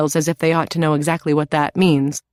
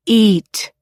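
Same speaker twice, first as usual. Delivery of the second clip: louder, higher loving order; firm tender command; 5 semitones higher, 5 dB louder than usual. A narrator's reading. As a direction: insist kindly but with no room to refuse. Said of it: "says tenderly, albeit in capital letters".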